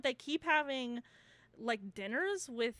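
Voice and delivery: ordinary talk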